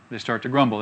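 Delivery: usual speaking voice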